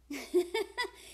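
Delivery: short real chuckle; a brief laugh between words. Real, not acted. Laughs during a pause while talking.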